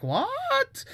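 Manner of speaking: very high-pitched incredulous squeal; mock shock. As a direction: let it shoot way up in disbelief. Acted shocked reaction of onlookers.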